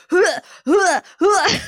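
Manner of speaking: high, loud breathy gag sounds; imitated gagging. Retelling how a pregnant friend gagged, imitated gags. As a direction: mimic a friend's helpless gagging fits. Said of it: "like, hysterically gagging".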